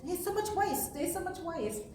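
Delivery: high, mock-amazed quote; mimicking fellow. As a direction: imitate a shocked newcomer. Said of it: "one of the fellows mentioned".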